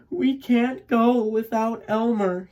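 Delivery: high, anxious mother's cry; mama crying out. A narrator's reading. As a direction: call out as a worried mother duck. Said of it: "he heard his mama cry".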